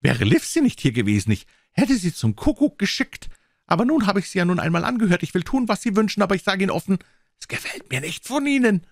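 put-on high, indignant old gentleman; indignant squire. A narrator's reading. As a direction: bluster like an offended squire. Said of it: "rief der Squire". (empörter Squire, hoch und erregt)

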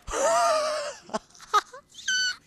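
high shrieking laugh; pterodactyl screech laugh. Real, not acted. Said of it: "scream like a pterodactyl".

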